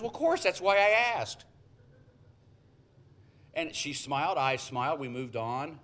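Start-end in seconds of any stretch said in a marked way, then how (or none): 0.0-1.5 indignant surprise. Retold or imitated. Cleaner defends her question.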